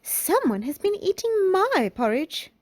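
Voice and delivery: high-pitched mother bear; middle-sized bear voice. A narrator's reading. Middle-sized bear looking at her bowl, high-voiced complaint. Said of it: "said in her middle-sized voice".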